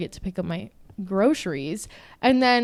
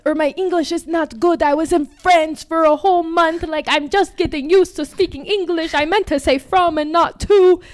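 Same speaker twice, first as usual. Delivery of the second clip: high-pitched, insistent mock protest; French boy; 6 semitones higher, 6 dB louder than usual. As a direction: mimic him pleading his case loudly and high. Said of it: "French boy".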